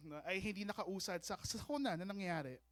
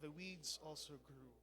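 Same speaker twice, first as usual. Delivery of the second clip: scripture reading; 2 semitones lower, 16 dB quieter than usual. Real, not acted. Pastor reading the parable with the congregation, hushed scripture reading. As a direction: read scripture softly, phrase by phrase.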